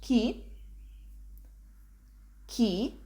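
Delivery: Chi as "kee" with rising pitch; upward question intonation. Shown as a demonstration. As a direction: ask Chi as a one-word Italian question. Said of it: "with a slightly upward intonation".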